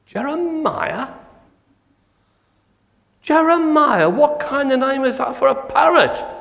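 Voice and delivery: high-pitched mocking burglar voice; incredulous squeak. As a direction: squeak it out in mock disbelief. Burglar mocks parrot's name.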